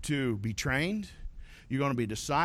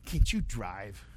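put-on snotty teen; 6 semitones lower, slower than usual. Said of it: "I give her a dirty look".

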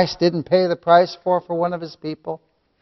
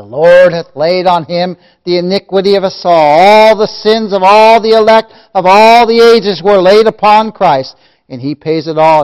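loud, driving sermon crescendo; full-force preaching; 4 semitones higher, 12 dB louder than usual. Real, not acted. Preacher proclaiming all sins laid upon Christ, full-force preaching. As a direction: pile up each phrase louder and higher.

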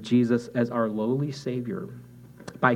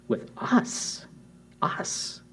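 hushed whisper; 8 semitones higher, 4 dB quieter, much slower than usual. Real, not acted. Preacher hushes on key phrase.

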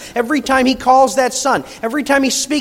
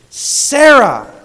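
Sarah punched louder and higher; stress on Sarah; 4 semitones higher, 7 dB louder than usual. Shown as a demonstration. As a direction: hit Sarah hard, set apart by pauses. Showing the emphasised noun in verse.

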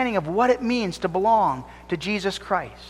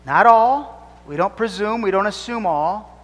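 ordinary talk, nothing marked

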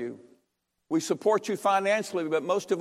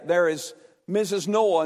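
ordinary delivery

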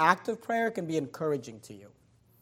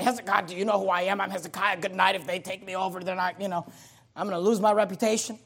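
mock self-important prayer; 4 dB quieter, faster than usual. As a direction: rattle off a mock complaint to God. Mock prayer Hezekiah did not say.

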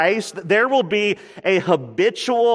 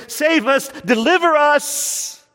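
higher, rising cry, "deliver us" stretched out slow; crowd's plea; 9 semitones higher, slower than usual. Retold or imitated. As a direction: call out like a pleading crowd. Crowd crying out to be saved.

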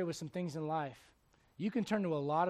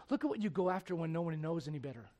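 usual speaking voice

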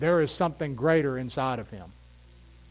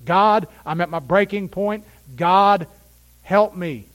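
louder pleading prayer; cry of the heart; 4 semitones higher, 6 dB louder, slower than usual. Pretend. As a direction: beg God at the breaking point. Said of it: "the cry of your heart".

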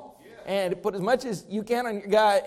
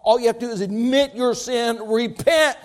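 usual speaking voice

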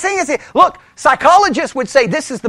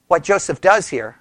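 ordinary delivery

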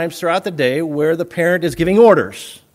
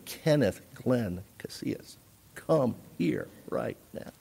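very quiet, low, deliberate order; low, measured parental warning; intense scolding mother; 5 semitones lower, 16 dB quieter than usual. Retold or imitated. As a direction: imitate mother using every name, dangerously hushed. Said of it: "when things got really intense".

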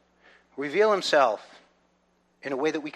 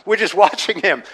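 mock excited crowd; 4 semitones higher, 8 dB louder than usual. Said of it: "it was like".

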